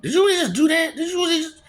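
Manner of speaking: mock disbelief. Incredulous pitched-up disbelief.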